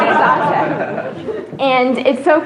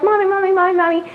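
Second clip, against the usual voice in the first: put-on clamoring kids; 7 semitones higher, faster than usual.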